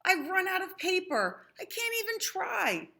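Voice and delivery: mock dismay for a child audience; lightly voiced upset Invisible. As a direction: Invisible out of paper, mildly distressed. Invisible despairs, pitch raised.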